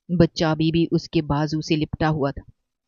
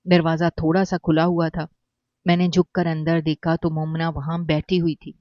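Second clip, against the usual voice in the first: ordinary delivery, nothing marked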